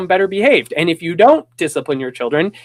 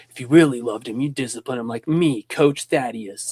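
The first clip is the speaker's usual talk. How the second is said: hammed-up boomer coach lecturing; Coach Thaddeus; 5 semitones lower, 4 dB quieter than usual. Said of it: "that boomer energy".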